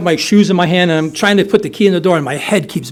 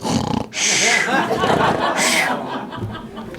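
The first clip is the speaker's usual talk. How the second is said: imitated laugh; 5 semitones higher, 5 dB quieter than usual. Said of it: "she's like".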